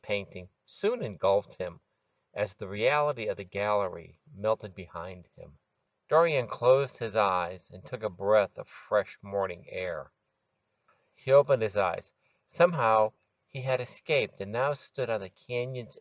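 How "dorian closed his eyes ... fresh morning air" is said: usual speaking voice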